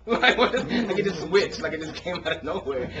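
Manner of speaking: high, loud laughing disbelief. Real, not acted. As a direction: laugh through the words in amazement. Laughing while retelling the story.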